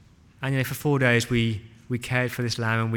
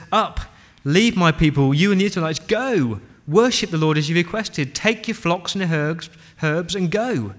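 loud, raised-pitch royal order; forceful command; 4 semitones higher, 6 dB louder than usual. Acted. Pharaoh summoning Moses at night, loudly commanding. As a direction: order them out with full force.

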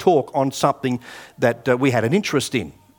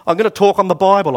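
loud, raised-pitch retold declaration; imitating a nervous soldier speaking up, high and loud; nervous announcement, blurted; 7 semitones higher, 8 dB louder than usual. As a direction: blurt it out loudly to the commander. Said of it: "I said it very nervously".

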